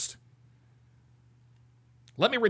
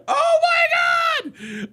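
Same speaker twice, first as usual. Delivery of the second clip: very slow, much higher, louder wail; drawn-out disbelief shout; 4 dB louder, much slower than usual. Real, not acted. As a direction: stretch it out in shocked delight. Stunned by the clip.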